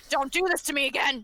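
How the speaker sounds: screaming. Acted. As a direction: cry out as if haunted. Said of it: "then he starts screaming".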